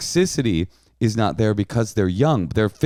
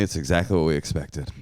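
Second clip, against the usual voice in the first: normal talking voice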